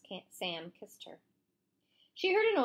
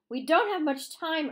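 urgent, higher and louder; urging; 8 semitones higher, 7 dB louder than usual. A narrator's reading. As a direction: press him to act now. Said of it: "urged Catherine".

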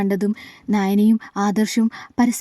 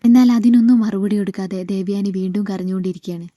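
ordinary delivery

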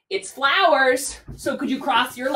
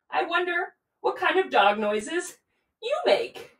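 ordinary talk, nothing marked

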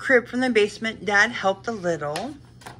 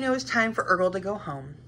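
plain reading voice

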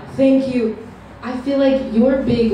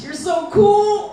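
high, slow, louder "you're so cool"; awe-struck gush; 9 semitones higher, 4 dB louder, much slower than usual. Retold or imitated. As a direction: gush like a starstruck fan. Awed exclamation to God.

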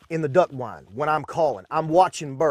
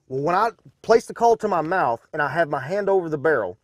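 ordinary talk, nothing marked

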